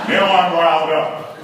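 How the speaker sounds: slow, loud announcement; riled up. Acted. Comic telling crowd he snapped, riled up. Husband reacting after being poked.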